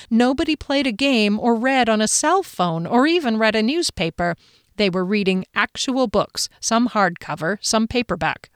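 ordinary delivery, nothing marked

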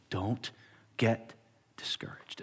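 slow, soft, breathy plea; an older man with tears urging him on; tearful whisper. Retold or imitated. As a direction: whisper it with quiet urgency.

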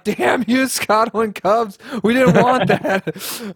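high, slow whiny complaint; mock angry fan. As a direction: drag it out like a sulky fan. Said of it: "They're like".

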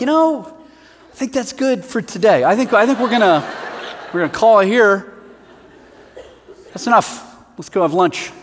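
hammed-up "that's enough, let's eat"; mock wrap-up. Retold calling off the preaching.

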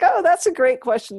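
playfully delighted giggle; giggling. Retold or imitated. Monk amused by her spiritual-master question, giggling. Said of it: "And he's giggling".

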